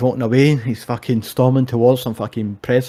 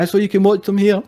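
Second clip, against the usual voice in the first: high mock presenter voice; high-pitched retold plug; Bisping trying to speak; 9 semitones higher than usual. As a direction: imitate an interviewer talking over an argument, pitched up. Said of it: "Bisping was trying to speak, and he's like".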